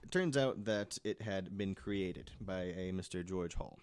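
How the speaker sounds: usual speaking voice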